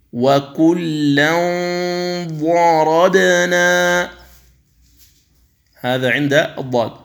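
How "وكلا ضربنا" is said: ينظرون drawn out with the nasal hum held; slow tajweed recitation. Shown as a demonstration. Teacher demonstrating ikhfa before ظ, slow recitation. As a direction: chant ينظرون slowly through the nose. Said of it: "التنوين".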